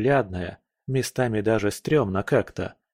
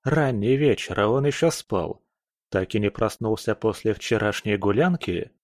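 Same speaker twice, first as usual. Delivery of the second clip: ordinary delivery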